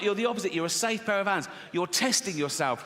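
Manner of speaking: ordinary talk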